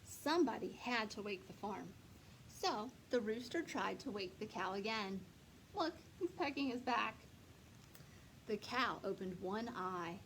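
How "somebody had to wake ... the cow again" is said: plain reading voice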